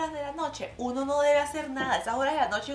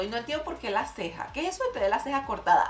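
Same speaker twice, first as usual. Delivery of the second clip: normal talking voice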